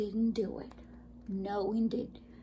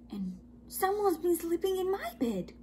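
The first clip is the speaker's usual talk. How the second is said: higher, lightly voiced Mama Bear; a hint of a put-out mother bear; medium-sized voice; 8 semitones higher than usual. A narrator's reading. Mama Bear finds her bed used, higher medium-sized voice. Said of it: "in her medium-sized voice".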